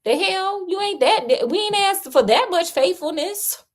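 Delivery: high-pitched mock indignation; high, protesting rant in character; pissed off. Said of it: "and he was pissed".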